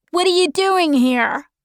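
a hint of a sharp young boy; lightly voiced boy. A narrator's reading. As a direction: question them like a wary kid.

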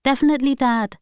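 put-on a kid placing a bet; child's voice. A narrator's reading. Twin son watching parents fight, childlike.

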